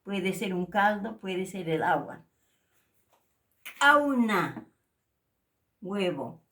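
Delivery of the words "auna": "Auna" said loudly with a glottal catch; glottal k model. Shown as a demonstration. Teacher modelling the glottalized k. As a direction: stress "Auna" with a glottal stop. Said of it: "afectado por el signo este".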